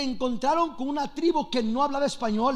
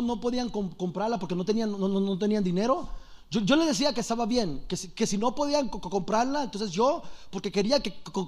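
lower, halting stammer; stuttering Juan; 4 semitones lower, 5 dB quieter than usual. Acted. Juan explains his sales method to church members, low soft stutter. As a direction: stumble softly on syllables and repeat words. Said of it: "nadie quiere oír a un tartamudo".

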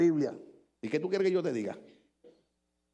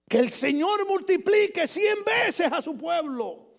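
slow, higher ringing shout; proclaimed; 9 semitones higher, slower than usual. Shown as a demonstration. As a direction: proclaim it with relish. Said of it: "Mire qué elegancia". (proclamación lenta y fuerte)

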